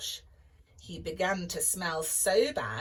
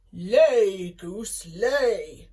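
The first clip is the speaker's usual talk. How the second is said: loud booming order to the goose; giant commanding; 11 dB louder, much slower than usual. Acted. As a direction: bark it at the goose.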